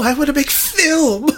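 put-on high whining kid pleading; whimpering child begging to make films; whiny child voice.

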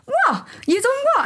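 high-pitched incredulous questions; squeaky mock shock at what they've heard; mock disbelief. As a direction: imitate stunned listeners, voice shooting up. Acts out people's shocked reaction.

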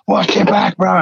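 alarmed warning. Retold or imitated. Friend grabs him in panic.

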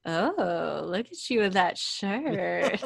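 lightly voiced dazed rocker admiring a shirt; a hint of a zonked-out compliment; mild mock stoner voice; spaced-out addict. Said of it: "and he goes".

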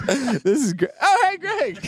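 high, laughing delighted greeting; laughing in surprise. Real, not acted. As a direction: laugh through the shock. Surprise party reveal.

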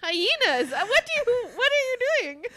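loud, high, baffled what are you doing; baffled outburst. Real, not acted. Confused friend demands what's going on, baffled and loud. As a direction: throw the question back in disbelief. Friend answers with wrong animal.

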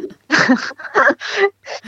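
rire aigu et surpris; éclater de rire, gênée. Real, not acted. Mise en difficulté, éclate de rire. (rire gêné et spontané)

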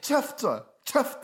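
high, affected put-on voice; Johan. Co-host imitating an absent friend on request, affected voice. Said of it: "det där är ju han, han har en tillgjord röst".

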